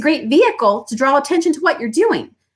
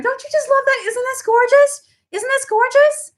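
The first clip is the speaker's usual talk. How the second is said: much higher, breathless delight; gushing to a friend. Retold or imitated. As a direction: rave about it to your friend. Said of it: "they say to their friend".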